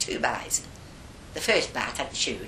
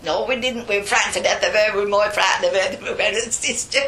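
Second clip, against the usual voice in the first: loud, fast, raised-pitch denial; hasty protest; 6 semitones higher, 7 dB louder, faster than usual. Retold or imitated. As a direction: rush out the excuse in protest. Acts protest, frightened, rushed.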